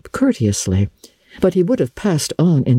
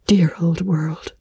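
murmured; 5 semitones higher than usual. A narrator's reading. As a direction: breathe it out almost in a whisper. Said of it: "she murmured".

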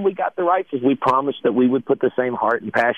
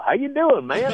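high mock driver voice; a much higher voice for the cab driver; higher-pitched San Antonio cab driver.

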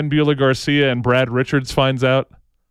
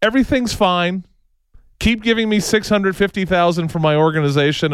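usual speaking voice

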